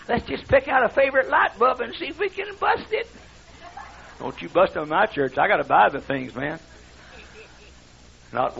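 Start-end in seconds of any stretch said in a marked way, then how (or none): 0.1-3.1 higher-pitched mocking imitation of a song leader; sarcastic send-up of a cheery worship leader. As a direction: parody a peppy song leader with scorn. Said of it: "He says".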